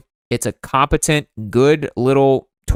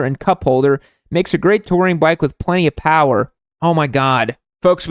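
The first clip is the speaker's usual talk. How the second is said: ordinary delivery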